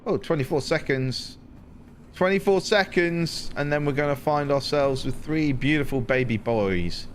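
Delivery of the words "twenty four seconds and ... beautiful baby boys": excited. Real, not acted. Excited countdown to new pods.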